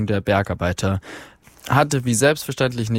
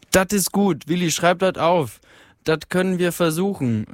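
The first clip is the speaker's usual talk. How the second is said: a higher Ruhr-dialect call from the crowd; 6 semitones higher than usual. Acted. A miner in the crowd calls out a higher Ruhr-dialect cheer. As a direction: shout your approval from the back of the hall. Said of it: "rief eine Stimme".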